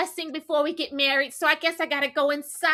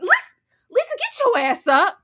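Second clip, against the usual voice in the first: loud, high call to a friend; yelling at her to stand up; 7 semitones higher, 5 dB louder than usual. Retold or imitated. Calling out to Lisa.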